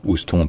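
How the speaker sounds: "Houston" dit ous-ton, sans h; lecture à la française. Shown as a demonstration. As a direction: finir "Houston" sur on. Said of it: "en français, on est tenté de lire". (« Houston » à la française, ous-ton)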